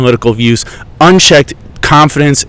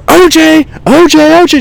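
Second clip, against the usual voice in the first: high sing-song call of "O J"; shrill kid call. Retold or imitated. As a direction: chant "O J" way up high like a kid at play. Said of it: "and I go".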